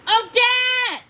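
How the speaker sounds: loud, high booming shout; thundering call. A narrator's reading. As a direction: roar her name across the castle grounds.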